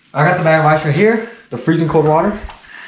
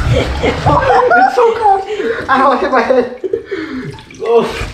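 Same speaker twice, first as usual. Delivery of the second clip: high, slow laughing shiver; 10 semitones higher, much slower than usual. Real, not acted. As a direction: laugh through the freezing cold. Laughing in ice water.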